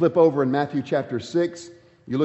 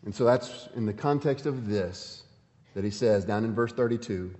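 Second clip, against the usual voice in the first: ordinary talk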